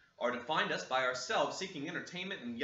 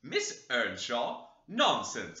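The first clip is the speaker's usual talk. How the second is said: slow, drawn-out disbelieving question then louder; incredulous cry; 4 dB louder, much slower than usual. Acted. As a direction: scoff at the very idea. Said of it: "cried the dame".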